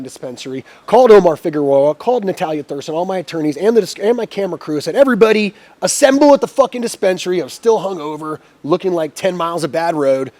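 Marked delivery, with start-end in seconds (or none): 5.0-7.4 raised, higher-pitched call to the troops; rallying call. Retold or imitated. Owner to his lawyers and camera crew, rallying call. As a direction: call everyone in, voice lifted.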